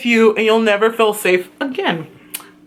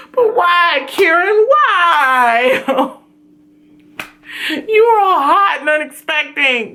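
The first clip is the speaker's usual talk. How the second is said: mock anguish; 3 semitones higher, much slower than usual. Real, not acted. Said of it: "fan lamenting the killer reveal".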